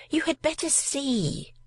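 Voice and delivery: high, slow female voice; a lady calling from upstairs. A narrator's reading. Invisible female speaks.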